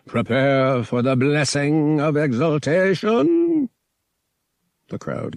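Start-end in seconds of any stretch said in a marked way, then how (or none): 0.1-3.7 slow, high, loud pronouncement; solemn high priest. Acted. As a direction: proclaim it from the altar, drawn out. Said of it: "the high priest pronounced".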